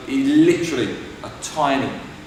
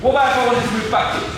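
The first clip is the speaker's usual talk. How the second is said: higher, projected teaching voice; calling out a question; 9 semitones higher, 4 dB louder, faster than usual. Real, not acted. Coach quizzing pupils on footwork, calling out. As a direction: put the question to the whole class.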